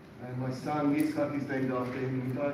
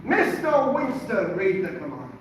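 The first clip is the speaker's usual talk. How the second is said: loud Irish rabbi; 4 semitones higher, 6 dB louder than usual. Retold or imitated. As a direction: call the student out to read. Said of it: "very loud voice".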